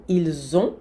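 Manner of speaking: "Ils ont" linked with z, silent final t; "Ils ont" with a z liaison and no t. Shown as a demonstration. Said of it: "a liaison obrigatória entre il e on".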